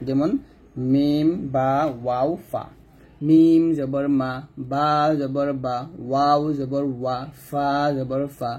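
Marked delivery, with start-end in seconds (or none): 3.3-8.5 slow, carefully from the lips: মিম জবর মা; slow Quran letter recitation from the lips; lip letters drill. Shown as a demonstration. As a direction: recite মা, বা, ওয়া, ফা slowly with the lips closing. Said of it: "ঠোঁট থেকে উচ্চারণ করব".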